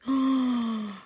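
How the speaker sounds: sharp delighted gasp; a quick intake of breath in awe. Real, not acted. Crafter seeing the laces, gasping in delight.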